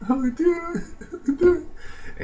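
Big Show's fake tears turning eager, squeaky mockery. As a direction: squeak it out laughing, mocking. Mocking Big Show's turn.

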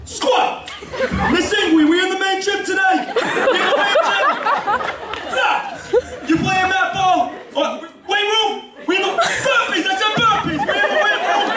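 high, shouting gym coach; teacher impression. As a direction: yell drills like a hyped gym teacher. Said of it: "This is what I see when I go into phys ed".

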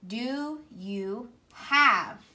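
slow, word-by-word rereading. Shown as a demonstration. Teacher rereading her sentence slowly for the class. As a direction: read it back slowly as you write. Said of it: "I can easily write them".

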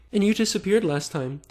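high-pitched mimicry of a complaint; put-on indignant whine; mock accusing. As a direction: read his accusation in a ridiculous high voice. Mocks predator's accusing message.

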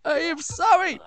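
high, loud mock-contrite outburst; indignant apology. Rodent answering the scolding cook, indignant apology.

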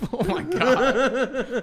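high, slow laughing disbelief. Real, not acted. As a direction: laugh it out, stunned. Laughing after the loud shout.

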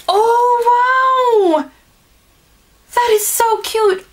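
high, loud thrilled squeal; delighted gasp. Real, not acted. Opening a gift from Maggie, thrilled squeal. Delighted unboxing a glass snowman.

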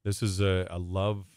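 usual speaking voice

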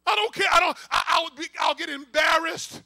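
loud, insistent outburst; urgent preacher acting a desperate pleader; defiant desperation. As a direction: shout it out, past all embarrassment. Acts out the shameless bold pleader.

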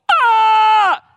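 loud high shriek of fright; hammed-up scream of terror; mock terrified scream. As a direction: scream like you think you'll die. People terrified by angels.

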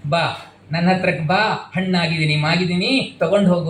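lightly voiced tree calling you closer; jackfruit tree inviting. Preacher voices the ripe tree inviting listeners, gently coaxing.

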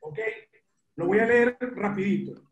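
usual speaking voice

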